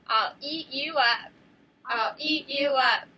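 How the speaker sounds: loud, slow monster voice; teacher voicing the crocodile threat, loud and slow; scary crocodile shout. Shown as a demonstration. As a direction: growl it out like a hungry crocodile. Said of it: "your scariest shouting voice".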